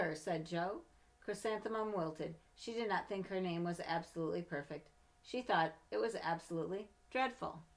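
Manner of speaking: storybook narration, gentle and lively; reading to children. Acted. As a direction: read it like a bedtime picture book. Reads picture book aloud.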